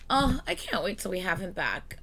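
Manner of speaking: ordinary talk, nothing marked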